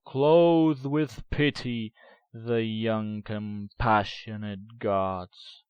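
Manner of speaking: loud, ringing oration of the poem; booming poetic lament, slowing on the last line; impassioned declamation. Acted. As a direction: hurl the lines at the fallen gods.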